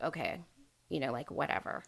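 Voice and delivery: low, soft, indifferent shrug; imitated unconcern. Captor looking at her as she yells, imitated unconcern. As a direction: brush it off quietly, as if unbothered. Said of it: "not concerned".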